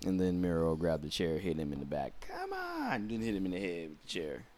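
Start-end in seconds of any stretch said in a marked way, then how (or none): 2.2-3.1 high, drawn-out mock yell, kept soft; imitated cry. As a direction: falsetto shout held back in volume. Retold yell during chair shot.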